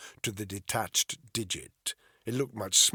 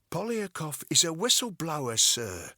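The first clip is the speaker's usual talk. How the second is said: put-on a touch higher, earnest young officer; lightly voiced Alice; 7 semitones higher, 4 dB louder than usual. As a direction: gently reason with her boss.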